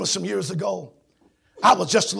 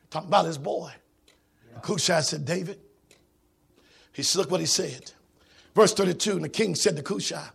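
normal talking voice

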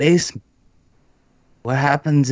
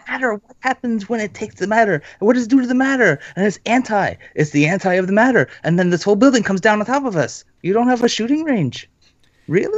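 panicked rant; 8 semitones higher, faster than usual. Acted. Panicked arguing about the gun.

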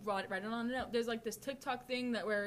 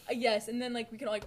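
ordinary talk

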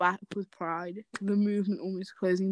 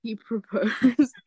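laughing; 3 semitones higher, 6 dB louder, much slower than usual. Real, not acted. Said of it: "Tea? Tea?".

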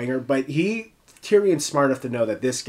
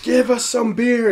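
high, loud imitation of a demanding prisoner; mock a rude heckler, pitched way up; 12 semitones higher, 6 dB louder than usual. Podcaster imitating the prisoner shouting in the background, mock rude yell. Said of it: "One of the other prisoners was rudely".

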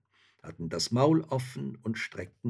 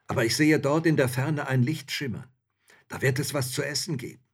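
raised, lively soldier's voice; eager soldier spotting hope, pitched up; hopeful soldier; 6 semitones higher, 6 dB louder, faster than usual. A narrator's reading. Soldier figure in a story.

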